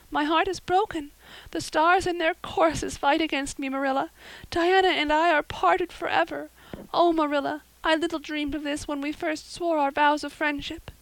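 put-on high, loud melodramatic girl's voice; tragic heroine. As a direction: declaim the heartbreak grandly. Anne's high dramatic voice.

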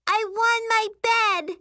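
high-pitched bratty insistence; little girl tantrum. Acted. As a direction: demand it like a stamping kid. Child stamps foot, tantrum.

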